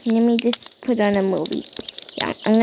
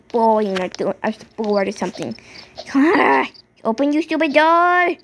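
ordinary delivery

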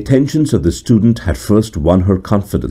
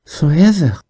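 solemn question; 9 semitones higher than usual. A narrator's reading. The stranger asking Wolfgang, solemn and high. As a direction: ask it gravely, almost disbelieving. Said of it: "said the stranger solemnly".

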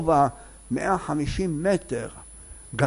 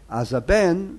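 normal talking voice

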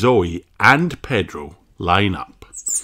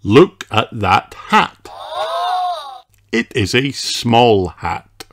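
put-on read-along for children; 13 semitones higher than usual. A narrator's reading. Reading a hat book to kids, lilting sing-song. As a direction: bounce through each hat line.

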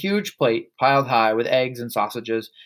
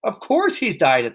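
higher, exasperated cry; cried out; 7 semitones higher, 3 dB louder than usual. A narrator's reading. Mother answering her son about Dad, crying out. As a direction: exclaim it, pitched up. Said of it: "the mother cried".

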